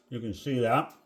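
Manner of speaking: talking with mouth full. Real, not acted. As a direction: speak around a mouthful. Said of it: "I know I'm talking with my mouth full".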